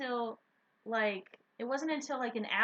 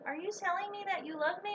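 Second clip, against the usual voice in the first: higher-pitched, hopeful question; retold excited question; 6 semitones higher than usual. Boyfriend interrupting her love letter, excited. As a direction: imitate an eager boyfriend, pitched up. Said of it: "he got excited, poor soul".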